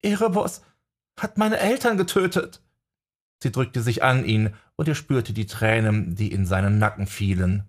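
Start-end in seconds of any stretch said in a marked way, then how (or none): 0.0-2.6 high voice choked with tears; snotty crying. A narrator's reading. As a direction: swallow hard, then sob it out. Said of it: "Jessica schluckte und sah ihn mit tränennassen Augen an". (verweint, hoch und erstickt)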